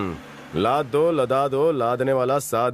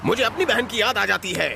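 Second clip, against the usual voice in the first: angry outburst; 10 semitones higher than usual. Acted. Angry at being called brother.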